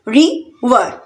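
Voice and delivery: River in two separate beats; split into syllables. Shown as a demonstration. Teacher counting out two syllables for learners, syllable by syllable. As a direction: pause between रि and वर. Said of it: "टू syllable river में भी".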